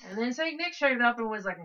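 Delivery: usual speaking voice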